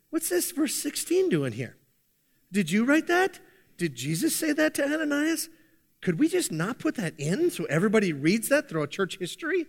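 high-pitched mock disbelief; imagined taken-aback Paul. Preacher plays Paul objecting to verse sixteen, mock incredulous. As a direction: question it as if caught off guard, half in jest. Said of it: "Can you imagine the Apostle Paul".

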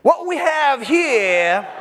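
slow, drawn-out shouted movie quote; high slow shouted quote; movie line shout. Retold or imitated. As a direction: bellow it slow like the prison captain. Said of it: "Best line in the movie is what?".

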